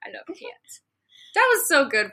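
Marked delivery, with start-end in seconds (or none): 0.0-0.8 breathless whispered adoration. Real, not acted. Whispering while looking at the listener's cat. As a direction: hush it out over the cat photo.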